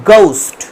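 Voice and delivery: "গাউস্ট" with hard g and diphthong; teacher's model "গাউস্ট", no breath after g; British RP pronunciation. Shown as a demonstration. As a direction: say "গাউস্ট" as the English do, vowel gliding into u. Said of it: "তাহলে কি sound করবে ওরা".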